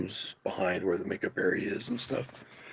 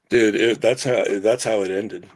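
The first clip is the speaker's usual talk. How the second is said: ordinary talk, nothing marked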